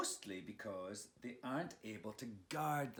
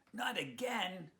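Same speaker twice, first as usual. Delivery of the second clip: wailing; 8 semitones higher, slower than usual. Acted. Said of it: "Leon wailed".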